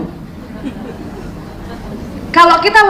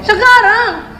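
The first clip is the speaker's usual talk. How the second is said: higher whiny demand; insistent child; 6 semitones higher, much slower than usual. Retold or imitated. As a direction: push it like a spoiled kid. (anak merengek dengan nada lebih tinggi)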